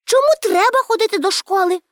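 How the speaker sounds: put-on high, challenging girl's voice; questioning Pippi. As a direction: throw it back as a high childish question. (здивований високий дитячий голос)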